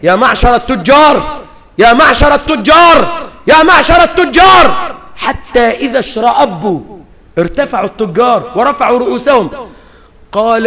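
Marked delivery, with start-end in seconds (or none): 0.0-4.9 calling out, building from plain to a high shout; a call to the merchants that swells to a shout. Retold or imitated. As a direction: call the traders, louder each time. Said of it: "فظل ينادي فينا".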